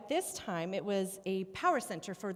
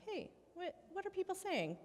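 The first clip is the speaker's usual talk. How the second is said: light, offhand and higher, like tossing out a quick question; breezy "what are people saying?", a buddy checking in; casually asking friends what people think; 9 semitones higher, 4 dB quieter than usual. Shown as a demonstration. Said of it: "I wonder if he did it all casually".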